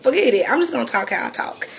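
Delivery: usual speaking voice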